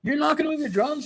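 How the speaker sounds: high, indignant retold challenge; mad disbelief. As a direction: act out being fed up and incredulous. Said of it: "I got really mad".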